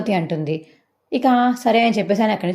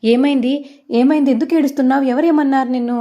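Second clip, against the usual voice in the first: higher and louder, hurried worry; anxious questions; 6 semitones higher, 4 dB louder than usual. A narrator's reading. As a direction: demand to know who upset her. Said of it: "కంగారు కంగారుకి అయితే".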